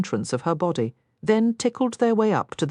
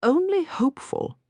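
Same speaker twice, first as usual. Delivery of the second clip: higher, light smiling reply; 11 semitones higher than usual. Acted. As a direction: say it with a hopeful smile.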